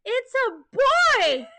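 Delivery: slow, high-pitched mock jubilation; excited announcement. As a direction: imitate a wife exclaiming, high, loud and stretched out.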